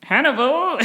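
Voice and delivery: mock scolding cry; exasperated exclamation. Real, not acted. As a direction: call him out, amused and outraged. Exasperated call at character.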